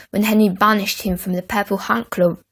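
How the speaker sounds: plain reading voice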